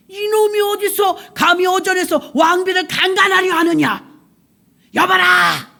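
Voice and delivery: high, loud royal fury; enraged king shouting orders; thundering command. Acted. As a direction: roar for the guards. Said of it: "불호령이 떨어졌어요".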